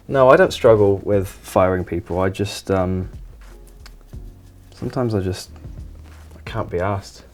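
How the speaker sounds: ordinary talk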